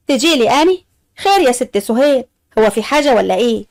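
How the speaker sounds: ordinary delivery, nothing marked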